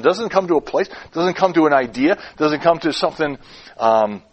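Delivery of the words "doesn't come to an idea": usual speaking voice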